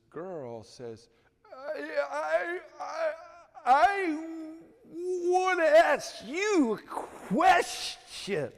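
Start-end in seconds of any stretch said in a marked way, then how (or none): 1.5-8.5 high, loud stammer catching on every sound; stuttering. Retold or imitated. A mock stutter for the preacher boy telling his dream. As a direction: push out each broken syllable, high and strained.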